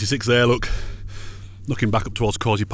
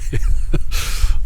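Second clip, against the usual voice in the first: breathy laugh; 5 dB louder than usual. Real, not acted. Laugh after joke.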